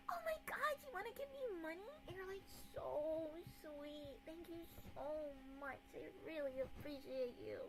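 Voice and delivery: very high, soft, sugary gush; V-tuber voice. Acted. Streamer thanking fans for money, high fake V-tuber voice. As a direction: coo thanks in a put-on squeaky-sweet streamer voice. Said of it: "I could do a V tuber voice".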